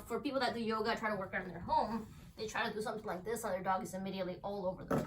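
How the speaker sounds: normal talking voice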